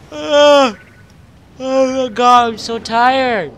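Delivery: high, loud groaning complaint; loud exhausted outburst. Pretend. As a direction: whine about being tired.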